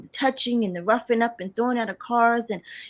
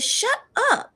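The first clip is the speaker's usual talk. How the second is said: disbelief; 13 semitones higher than usual. Retold or imitated. Reacting to the cashier saying she need not pay, shocked. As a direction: squeal it in pure disbelief.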